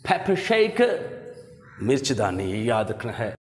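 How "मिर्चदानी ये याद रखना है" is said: ordinary talk, nothing marked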